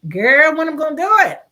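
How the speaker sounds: loud, high exclaimed question; retold protest. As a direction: retell a disbelieving comeback. Retells her reply to mother.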